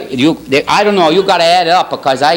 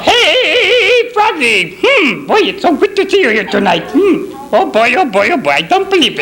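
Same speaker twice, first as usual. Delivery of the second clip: high, excited puppet voice; hammed-up puppet with little hums; puppet character impression; 9 semitones higher than usual. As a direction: greet the crowd in a jumpy cartoon voice. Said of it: "I'm gonna do the impression".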